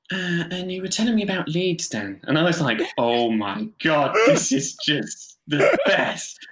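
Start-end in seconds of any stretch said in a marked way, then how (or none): 0.1-2.2 resume the chat with mock serene interest; psychotherapist voice. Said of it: "kind of psychotherapist voice". 3.0-6.4 very slow, drawn-out relish; awed delight. Retold or imitated. Awed reaction to absurd situation.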